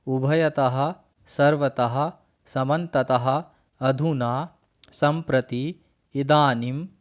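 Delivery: each word said separately and clearly, with a pause after it; reciting Sanskrit words. Shown as a demonstration. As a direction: call out the list word by word for the class to repeat. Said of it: "उनका एक बार उच्चारण कर लेंगे". (अव्ययों का एक-एक करके स्पष्ट उच्चारण)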